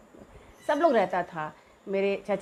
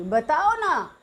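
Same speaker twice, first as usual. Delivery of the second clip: stubborn pleading; 5 semitones higher, 4 dB louder, much slower than usual. Retold or imitated. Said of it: "ज़िद करने लगी".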